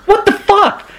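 high-pitched mock incredulity; imitated disbelief. Teammate eyeing the curved twig, imitated disbelief. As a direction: voice a baffled look at the offering. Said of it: "he's got this expression on his face like".

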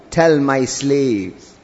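very slow, low and gentle; tender address. Acted. God speaking of his slaves, tender address. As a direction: let each word sink down, drawn out. God's words, tenderly voiced in sermon.

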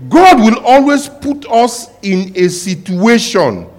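high, loud, fervent preaching; preaching at full force. Acted. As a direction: preach it out to the congregation.

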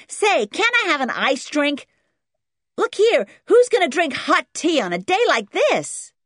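put-on high, loud, demanding kid; brash American boy. A narrator's reading. Boy demanding an iced drink, brash and loud. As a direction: demand it like a spoilt American child.

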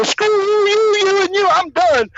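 high, drawn-out mock fury; comic exasperated outburst. Pranked staffer telling off the laughing guys, comic exasperated outburst. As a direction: stretch each "you" high, then quit.

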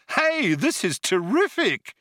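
high, eager, thrilled: terrific; gleeful at the danger, say terrific brightly; delighted. Acted. Zaphod thrilled by incoming missiles.

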